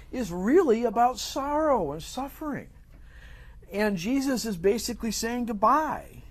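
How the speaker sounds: high-pitched, impassioned preaching; emphatic preaching. Real, not acted. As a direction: drive the teaching home, pitch lifted high. Preaches on God's sovereignty.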